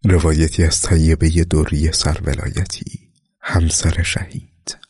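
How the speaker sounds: slow, measured reading of a chapter heading; chapter title announcement. A narrator's reading. Chapter heading read slowly.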